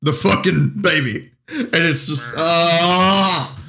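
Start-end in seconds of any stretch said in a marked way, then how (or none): 2.4-3.6 long, exasperated "ah"; exasperated groan. Real, not acted. Co-host ranting about a bad movie scene, exasperated groan. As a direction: let out a drawn-out groan of frustration.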